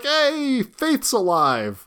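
high, drawn-out mock delight; hammed-up happy greeting; mock cheer. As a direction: call it out high, then stretch the ending. Acted viewer cheering a mention.

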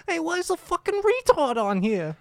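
squeaky put-on voice way up high; a kid heckling on the bus; high mock shout.

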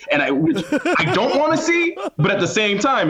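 high squeak. Real, not acted.

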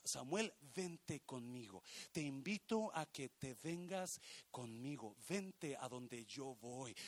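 soft, low coaxing; God's gentle invitation. Acted. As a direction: invite tenderly as God, hushed. God invites Samuel along.

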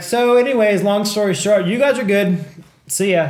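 high, loud imitation of an offhand cop; breezy dismissal. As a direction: wave them off in a mock-cheery voice. Imitating cops breezily dismissing them.

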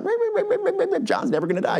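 high, fast gossiping babble; mock gossip chatter. Disciples spreading rumor, high quick gossiping chatter. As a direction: rattle it off like rumor mill chatter.

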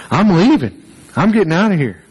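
high, loud announcement of walking out; indignant outburst. Retold or imitated. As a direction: declare it loudly, fed up. Acts out his young self storming out.

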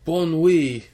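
high, loud hammy French; French accent. Pretend. French farewell, playful.

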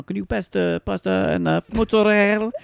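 high-pitched imitation, Italian on the food words; showy Italian. Sisters at dinner one-upping each other, showy Italian. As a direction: show off your Italian on the last word. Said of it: "one-up each other with how Italian".

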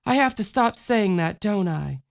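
put-on high childlike voice, steadier now; girl steadying herself; steadier little girl. A narrator's reading. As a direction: pull herself together like a small girl. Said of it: "in a steadier voice said".

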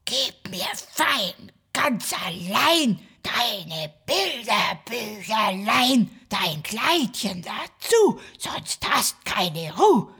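slow, menacing villain rhyme; mustache twirling villain. Acted. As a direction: drawl the threat like a villain.

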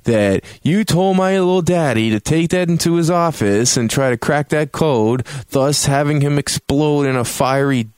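high-pitched mock outrage; hammed-up indignant daughter; upset daughter. Tiffany confronts Bruce over her dad's death, mock upset. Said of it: "she got real upset".

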